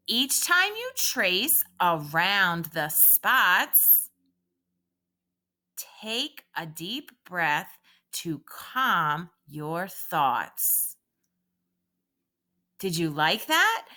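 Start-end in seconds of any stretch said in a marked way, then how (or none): 0.1-11.0 slow calming recital. Shown as a demonstration. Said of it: "Repeat after me".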